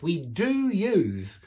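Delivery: very slow, weighty "do"; slow demonstration with an emphatic DO; stress on DO. Shown as a demonstration. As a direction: stretch out "do" so it stands out. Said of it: "you can see what I'm doing here".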